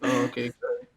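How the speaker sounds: bored, mumbled cashier reply; going through the motions at the till; flat rote cashier. Retold or imitated. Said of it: "she goes".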